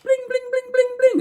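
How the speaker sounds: very high sung harp plinks; harp strumming. Retold or imitated. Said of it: "he's like".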